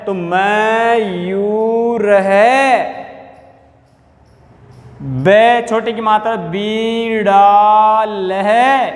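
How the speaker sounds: छात्र है drawn out, visarga breathed as है; slow visarga reading. Shown as a demonstration. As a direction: model it slowly. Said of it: "नीचे वाली में आ जाओ".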